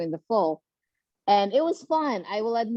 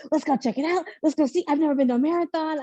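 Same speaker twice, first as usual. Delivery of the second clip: imitating the husband's eager, bouncing excitement, a bit higher; excited husband; 5 semitones higher than usual. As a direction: play the husband buzzing to go see the race. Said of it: "He was more excited than I am".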